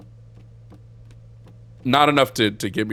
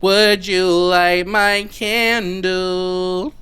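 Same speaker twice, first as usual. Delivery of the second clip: creepy slow plea; 9 semitones higher, 9 dB louder, much slower than usual. Pretend. Mock creepy request at altar.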